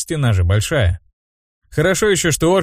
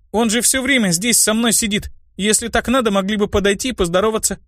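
put-on high child's voice, reproachful; indignant little girl; 11 semitones higher than usual. A narrator's reading. Girl telling mages to come greet him, high and indignant.

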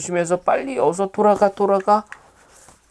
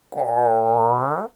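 long slow belly rumble; stomach growl; 2 semitones lower, much slower than usual. Acted. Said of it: "가부의 뱃속에서 소리가 울려 퍼졌습니다".

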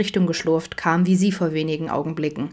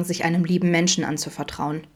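ordinary delivery, nothing marked

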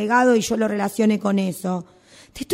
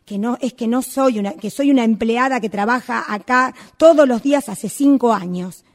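ordinary talk

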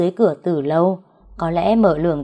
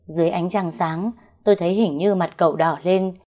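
plain reading voice

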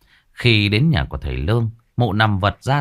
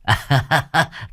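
short higher laugh to cover embarrassment; awkward laugh; 5 semitones higher than usual. A narrator's reading. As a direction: laugh it off sheepishly. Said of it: "òa lên rồi cười trừ". (cười trừ ngượng ngùng)

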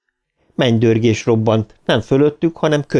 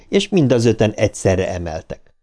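ordinary delivery, nothing marked

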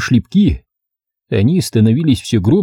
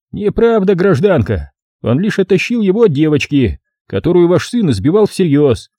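higher-pitched official rebuttal; firm policeman; 7 semitones higher than usual. Acted. Policeman contradicts the angry mother, raised firm voice. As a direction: set the citizen straight.

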